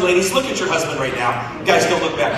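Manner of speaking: ordinary talk, nothing marked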